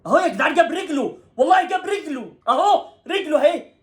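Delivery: high, loud insistence; heated protest. Real, not acted. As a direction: point it out hotly to the viewers. Protesting penalty replay.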